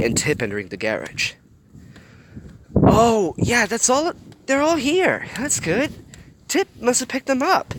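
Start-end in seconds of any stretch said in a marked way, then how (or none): 2.8-7.7 much higher, eager reader chatter; real excitement, pitched way up; excited. Real, not acted. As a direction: cheer that they're all here.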